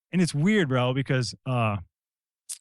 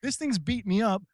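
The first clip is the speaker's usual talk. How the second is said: high, rising, fed-up whine; begging for sleep, higher and higher; whiny exhausted plea; 9 semitones higher than usual. Retold or imitated. Tired me begging Mother Ayahuasca for sleep, whining. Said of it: "I'm like".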